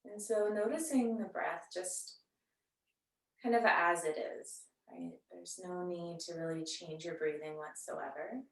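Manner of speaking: soft, unhurried yoga-teacher voice with long pauses; guided meditation. Real, not acted. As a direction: gently guide them into settling and noticing the breath. Leads seated breathing meditation.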